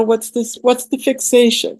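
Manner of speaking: ordinary talk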